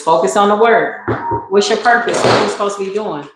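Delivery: usual speaking voice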